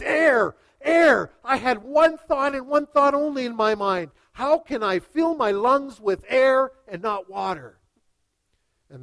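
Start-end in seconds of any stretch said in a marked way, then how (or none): 0.0-7.8 loud, high, urgent acted cry; desperate outburst. Student just pulled from the water answering his master, desperate and loud. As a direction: blurt it out as if starved of air.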